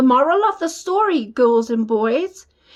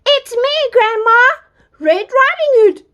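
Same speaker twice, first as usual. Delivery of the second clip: put-on little girl voice; 10 semitones higher than usual. Girl at the door.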